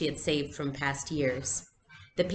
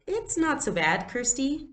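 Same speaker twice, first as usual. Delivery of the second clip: higher, gentle fib; coaxing reassurance; 10 semitones higher than usual. Acted. Big sister to little sister, gently coaxing. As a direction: soothe her sister kindly. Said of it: "lying a little".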